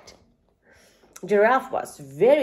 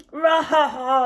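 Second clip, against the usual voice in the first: Lion laughing; 2 semitones higher, 6 dB louder than usual. A narrator's reading. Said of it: "laughed Lion".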